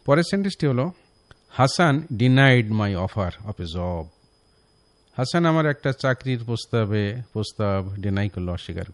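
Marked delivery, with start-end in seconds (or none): 1.5-4.0 slow dictation of the wrong sentence; slow wrong example. Shown as a demonstration. Teacher reads the wrong sentence to listeners, slowly. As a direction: spell it out slowly as the error. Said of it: "এটা একটি ভুল sentence".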